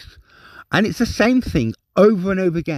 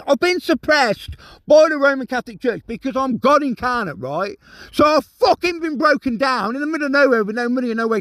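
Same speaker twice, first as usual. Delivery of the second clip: high-pitched, exasperated outburst; real angry tirade; 6 semitones higher than usual. Real, not acted. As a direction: rant at everyone who refused to help, pitch raised. Furious rant at unhelpful family, viewers.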